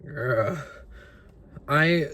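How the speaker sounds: usual speaking voice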